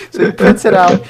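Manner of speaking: high, loud, through leftover laughter; real giggles pushing the words up; laughing. Real, not acted. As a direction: carry on telling it while cracking up. Still laughing at the story.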